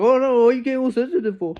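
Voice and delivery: high, fast whiny mock voice; mocking impression. Imitating a fake friend backtracking, whiny mocking voice. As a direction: sneer it back at them like a fake friend. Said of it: "You're like".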